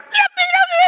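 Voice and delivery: very high, loud mock shriek; hammed-up scream. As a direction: push it into a parody scream.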